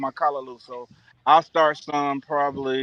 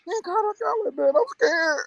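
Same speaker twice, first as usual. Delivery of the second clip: much higher squeaky imitation of his friend, half laughing; retelling his friend teasing him, in a high squeaky mock voice; high mock buddy voice. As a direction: play the friend in a high, mocking whine. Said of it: "He's like".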